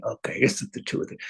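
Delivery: whispered quick self-assessment; quiet self-talk. Shown as a demonstration. Teacher shows students how to rate a feeling under their breath. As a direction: whisper it to yourself, fast. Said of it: "you have to say".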